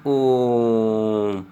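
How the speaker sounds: ओम held very long and slow; a long, drawn-out ओम; prolonged om. Shown as a demonstration. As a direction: stretch ओम out like a chant. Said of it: "ओम जो हो गया आपका यह ज़्यादा देर तक चला".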